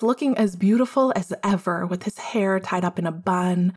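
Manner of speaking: ordinary delivery, nothing marked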